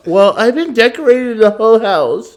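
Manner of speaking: high mocking voice imitating his wife. Husband mocks wife's excuse in a high put-on voice. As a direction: mimic her in a high put-on voice. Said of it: "she's like".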